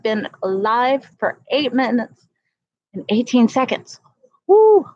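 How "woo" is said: sudden high, loud whoop; a burst of delight; excited whoop. Real, not acted. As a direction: let out a quick woo. Excited cry on the live stream.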